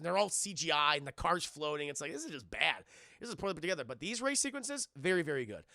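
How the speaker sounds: usual speaking voice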